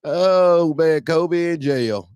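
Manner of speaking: slow, chuckling delivery; laughing. Real, not acted. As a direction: still amused, words stretched out. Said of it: "I'm laughing".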